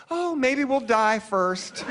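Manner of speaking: high, slow mock mother; mother's reply. Mother hearing what the new film is about, high drawn-out mock dismay. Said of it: "my mother had a good line".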